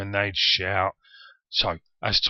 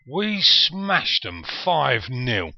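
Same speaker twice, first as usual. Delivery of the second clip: slow, high and louder, savouring each word; proud slow boast; 6 semitones higher, 4 dB louder, much slower than usual. Retold or imitated. Fan recalling the win over Halifax Town, proudly drawn out. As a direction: relish every word of the big win.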